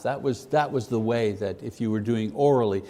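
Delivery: ordinary talk, nothing marked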